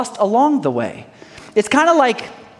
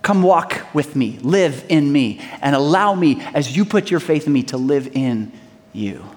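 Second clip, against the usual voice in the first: tender invitation; 7 semitones lower, 3 dB quieter than usual. Acted. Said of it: "He said".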